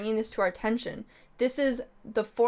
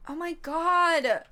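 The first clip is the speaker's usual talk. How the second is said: surprised gasp; 7 semitones higher, much slower than usual. Real, not acted. Reacting to game screen.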